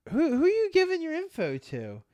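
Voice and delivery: high-pitched imitation of a worried wife; retold alarmed questioning. Wife challenges mom about scam, retold.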